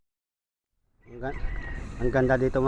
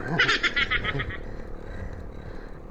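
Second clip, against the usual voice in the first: breathy laugh; 10 semitones higher, 5 dB quieter than usual. Real, not acted. Laughing, tired on the climb.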